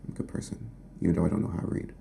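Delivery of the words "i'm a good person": breathy whispered repeat; whispered echo of the line. Pretend. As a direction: whisper it like a secret confession.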